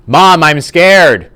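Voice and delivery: loud, high, drawn-out exclamation; big voice, just volume; loud shout. Acted. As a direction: belt it out slowly at full volume. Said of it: "I apologize that that was really loud".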